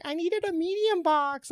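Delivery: high, slow, put-on sweet voice; mock whiny coworker. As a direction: play the innocent coworker who took the box, high and dragged out. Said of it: "And she's like".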